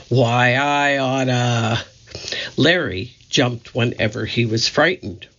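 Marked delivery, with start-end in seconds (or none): 0.1-1.8 tough gangster snarl, drawn out slow; gangster bark. Acted. Moe barking at the other stooges, a tough gangster growl. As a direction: bark it like a scrunch-faced tough guy. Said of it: "barked things like".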